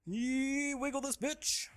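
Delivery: very high, loud goofy cry; silly high yell. Pretend. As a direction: shriek it like a hype goofball.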